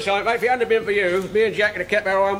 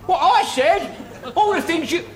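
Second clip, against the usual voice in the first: indignant; 8 semitones higher, slower than usual. Acted. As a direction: throw the blame straight back. Indignantly turning the blame back.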